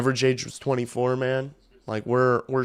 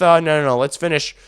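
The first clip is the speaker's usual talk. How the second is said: ordinary talk, nothing marked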